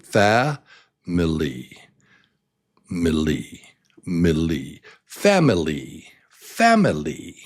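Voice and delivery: strong Fa, then low unstressed milli; family with a strong opening beat, falling away; stress on first syllable. Shown as a demonstration. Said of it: "loud and a little slower".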